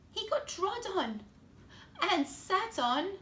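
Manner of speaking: put-on lively storybook voice for kids; reading to children. A narrator's reading. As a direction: read it like bedtime story time. Reads picture book to children.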